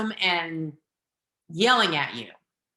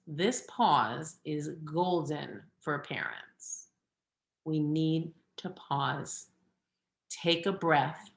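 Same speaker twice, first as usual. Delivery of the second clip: calm and slow; 7 dB quieter, slower than usual. Shown as a demonstration. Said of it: "deep breath, pause".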